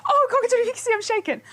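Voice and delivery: high shrieking panic close to crying; screaming in genuine terror; shouting in fear at a big spider; terrified, near tears. Real, not acted. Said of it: "I've got tears in my eyes".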